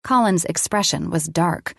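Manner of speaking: ordinary delivery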